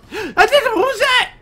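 startled gasp. Real, not acted. Sudden scare mid-game.

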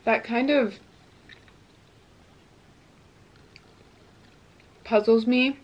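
talking between bites; chewing while talking. Real, not acted. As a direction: mouth partly full of food. Seen 1: Bites quesadilla piece at 1.6, then jaw works while talking.

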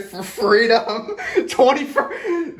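slow, high words squeezed through laughter; helpless laugh pushing the pitch way up. Real, not acted. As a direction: crack up mid-phrase. Cracks up at own patriotic bit.

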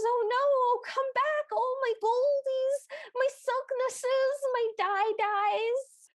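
high, drawn-out mock lament; hammed-up, babyish high-pitched grief; wailing trollop imitation. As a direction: whine after your lost treasures in a high voice. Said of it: "And she goes".

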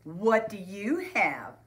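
loud, high, then drawn-out question; calling out. A narrator's reading. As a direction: call over to a classmate. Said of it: "called Buster".

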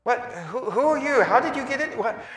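high, alarmed questions to an intruder; startled. Acted. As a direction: gasp out who are you, high and startled. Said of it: "everything going like".

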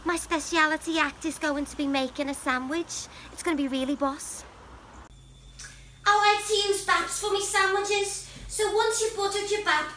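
put-on chirpy Liverpool Scouse accent. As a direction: Liverpool girl proudly showing off a crisp sandwich. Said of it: "I'm the Liverpool Rose".

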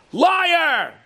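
high, loud accusing yell; furious shout. Retold or imitated. Father rips up son's money, furious shout. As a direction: spit out the accusation.

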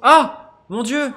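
startled loud cry of fright; scared horror game streamer. Real, not acted. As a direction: jump out of your skin. (cri de frayeur devant le jeu)

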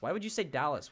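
ordinary talk

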